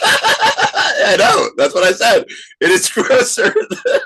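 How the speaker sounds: laughing through the words. Real, not acted. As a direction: giggle while speaking. Laughing while talking.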